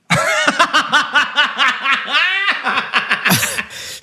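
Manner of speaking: long high-pitched laughing fit; helpless laughter. Real, not acted. Cracking up at co-host's classic David Warner, helpless laughter. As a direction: burst out in high, rolling laughs.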